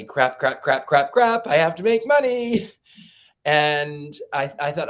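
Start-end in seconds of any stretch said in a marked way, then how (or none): 0.0-2.7 crap repeated, rising higher and louder into panic; building panic. Retold or imitated. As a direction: spiral from muttered worry to a loud panicked outburst. Panicking about losing income.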